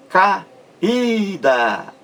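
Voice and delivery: Caída with a pause after ca, then a long high í; stretched stressed syllable. Shown as a demonstration. Teacher showing the sílaba mais forte, Caída split with stretched í. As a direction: break off ca, then draw out the ída of Caída. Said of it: "A sílaba mais forte é o I".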